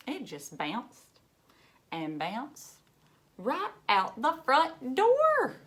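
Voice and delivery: put-on lively read-aloud for children; storybook reading. A narrator's reading. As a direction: read it like a picture book to a class. Reads picture book aloud to children.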